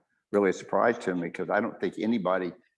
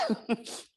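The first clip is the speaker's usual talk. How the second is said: nervous laugh; 5 dB quieter than usual. Real, not acted. Stunned laugh after her claim.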